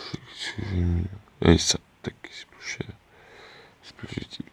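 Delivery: barely audible whispered muttering; whispered self-talk. Real, not acted. Player talking to himself while sorting the game, whispered muttering. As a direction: whisper it under your breath to yourself.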